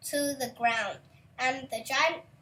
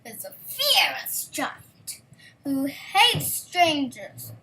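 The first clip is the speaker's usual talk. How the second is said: slow, louder warning about the giant; slow and ominous, louder; 2 semitones higher, 7 dB louder, slower than usual. A narrator's reading. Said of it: "said the woman".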